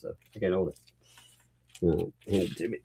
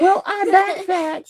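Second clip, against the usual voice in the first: very high, slow falsetto; mock-wailing put-on girl voice; Megan Fox; slower than usual. Dad as the threatened celebrity caller, high girl voice. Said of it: "girl voice".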